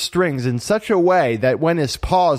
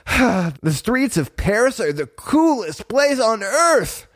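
high, slow and awed kitten voice; excited kitten; 8 semitones higher, slower than usual. A narrator's reading. Berlioz walking the streets with his siblings, excited. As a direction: stretch it out with high-pitched wonder.

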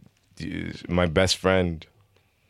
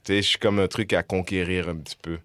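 ordinary delivery

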